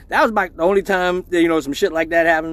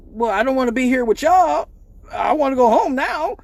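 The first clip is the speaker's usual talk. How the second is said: put-on whiny girlfriend; 9 semitones higher, 5 dB louder than usual. Girlfriend answers lady cop.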